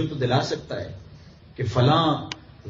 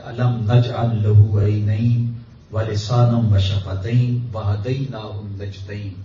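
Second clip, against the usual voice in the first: slow, measured Arabic recitation; Quran recitation; 4 semitones lower, much slower than usual. Shown as a demonstration. Preacher citing what Allah says, slow recitation. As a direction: draw each word of the verse out.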